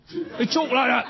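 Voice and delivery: mock Cockney. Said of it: "they all talk like cockneys".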